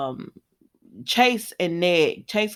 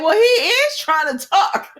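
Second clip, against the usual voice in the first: high, louder, slow, laughing through it; laughing retort; 14 semitones higher, 7 dB louder than usual. Real, not acted. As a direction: laugh while you point it out. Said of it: "I said".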